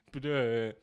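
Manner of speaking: ordinary talk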